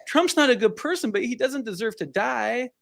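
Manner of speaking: put-on high little kid voice; child voice. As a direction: voice a shocked six-year-old, high. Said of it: "and he goes".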